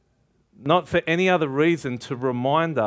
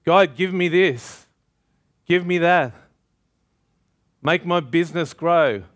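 put-on louder and higher, demanding like a spoiled child; pushy shopping-list prayer, louder and higher; greedy demanding prayer; 4 semitones higher, 3 dB louder than usual. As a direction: rattle off demands to a spiritual Santa Claus. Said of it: "like a spiritual Santa Claus".

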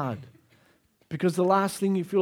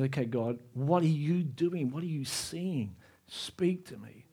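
quiet prayer; 4 semitones lower, 8 dB quieter than usual. Retold or imitated.

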